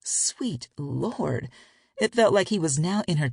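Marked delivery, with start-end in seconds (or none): none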